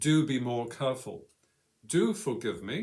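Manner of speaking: authoritative stress on the word Do; emphatic imperative. Shown as a demonstration. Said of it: "emphasis of the voice is in the first word".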